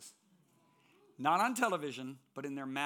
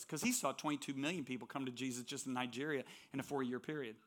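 usual speaking voice

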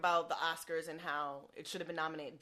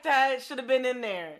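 mock fans; 5 semitones higher, 9 dB louder than usual. Voicing fans' reaction.

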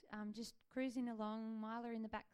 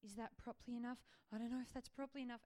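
anxious fast; faster than usual. Retold or imitated. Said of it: "and then think".

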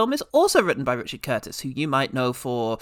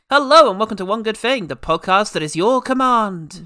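upbeat host welcome, louder; podcast host intro; 5 semitones higher, 6 dB louder than usual. Acted. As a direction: announce the show with a grin.

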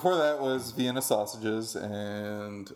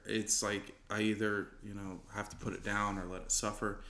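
quiet, low confession trailing softer; mock really sad; 4 semitones lower, 6 dB quieter than usual. Comic recreating a bit for co-host, mock sad. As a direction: deliver it low and subdued, a hammed sad bit. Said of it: "like, saying really sad shit".

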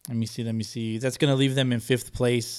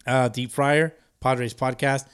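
ordinary delivery

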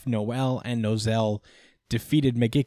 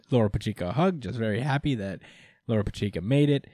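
ordinary talk, nothing marked

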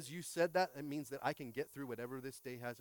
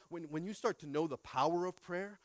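ordinary talk, nothing marked

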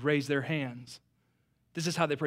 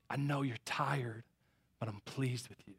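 tender reassurance; 4 semitones lower, 9 dB quieter than usual. Retold or imitated. The Lord comforting the exhausted preacher, soft and tender. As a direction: soothe a tired son. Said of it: "He's like".

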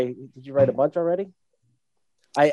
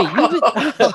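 voice cracking; 6 semitones higher than usual. Real, not acted. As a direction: voice cracks mid-laugh. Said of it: "I like how my voice cracked".

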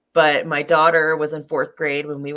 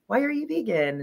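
lightly voiced curious kid, pitched higher; 9 semitones higher than usual. Classmate questioning the vegan daughter, higher curious kid voice. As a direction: mimic a classmate asking, a touch childlike and higher. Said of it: "So kids did ask questions".

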